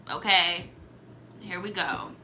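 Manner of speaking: ordinary talk, nothing marked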